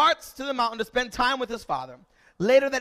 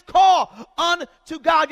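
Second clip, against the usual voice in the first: "Call unto God" belted out, drawn slow; slow shouted call; loud slow refrain; 7 semitones higher, 13 dB louder, much slower than usual. Acted. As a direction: hammer the refrain home. Refrain in preached list.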